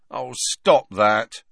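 drawn-out, mildly pained plea; pleading. A narrator's reading. Nervous Ron begging his friend to stop, mildly pleading. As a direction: beg her, stretching the words. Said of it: "Ron begged her".

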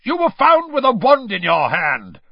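high, loud angry accusation; barked. A narrator's reading. Official accusing elf caught with wand, barking. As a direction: bark the charge at the culprit. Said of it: "barked Mister Diggory".

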